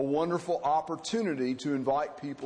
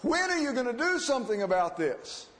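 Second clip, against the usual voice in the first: crying out; 7 semitones higher than usual. Acted. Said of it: "he cries out".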